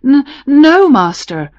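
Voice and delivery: stammered, nervous reply, starting high and loud; startled boy stumbling over his first word; a little frightened. A narrator's reading. Shepherd boy answering his stern master, a little frightened. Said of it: "who was a little frightened".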